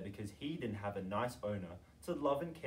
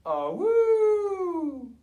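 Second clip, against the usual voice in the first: high, loud howling woo; a dog howling with all its lungs; 12 dB louder than usual. A narrator's reading. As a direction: howl like a little sausage dog. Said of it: "He howled and howled".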